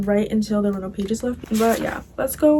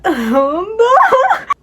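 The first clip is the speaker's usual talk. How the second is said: high squealing laugh of embarrassment; embarrassed squealing laugh; 11 semitones higher, 5 dB louder than usual. Real, not acted. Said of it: "and that made me scared".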